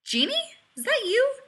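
high, loud startled questions; astonished. A narrator's reading. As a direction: gape in disbelief at the caller. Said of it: "Rodney gaped at the phone".

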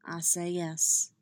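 slow, simple and sincere; simply. A narrator's reading. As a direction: say it plainly and tenderly. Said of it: "he said simply".

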